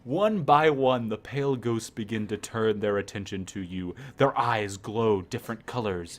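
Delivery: low, deliberate storyteller; spooky narration. Acted. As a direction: tell it like a ghost story. Reads the ghost scene.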